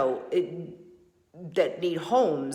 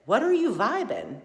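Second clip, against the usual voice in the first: a challenging question put to herself; pointed self-question; 5 semitones higher than usual. Retold or imitated. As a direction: ask yourself bluntly, a bit raised. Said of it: "You're doggone right I said".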